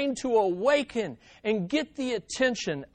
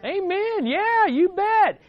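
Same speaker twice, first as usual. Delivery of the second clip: much higher, joyful call; excited amen; 12 semitones higher, 5 dB louder, slower than usual. Real, not acted. Preacher rousing the church, high and joyful. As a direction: lift the room with it.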